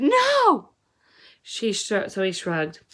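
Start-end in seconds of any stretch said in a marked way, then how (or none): 0.0-0.7 sharp, high, loud outburst; a girl blurting a sudden refusal; blurted no. A narrator's reading. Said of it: "She blurted".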